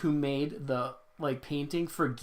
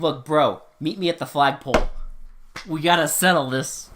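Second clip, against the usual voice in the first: loud mock challenge; mock tough guy; 9 dB louder than usual. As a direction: call him out like a bully. Mock fight challenge to director.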